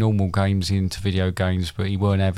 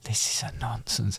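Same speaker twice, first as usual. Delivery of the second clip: softer whispered catchphrase; whispering; 8 dB quieter than usual. Pretend. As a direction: echo it in a hush. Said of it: "Why are you whispering it?".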